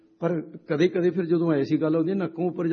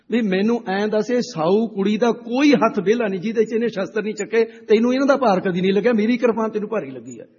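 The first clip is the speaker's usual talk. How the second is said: higher and louder, pressing the point home; a preacher driving home the final blow in a retold argument; rising challenge, hammering each line; forceful retort; 7 semitones higher, 6 dB louder than usual. Preacher's climactic retort in story.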